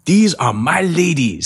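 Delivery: drawn-out pitchman brag, weak mock Vietnamese accent; Tom Vu. Host mimics guru showing off, slow half-done Vietnamese accent. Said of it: "say it with a really bad accent".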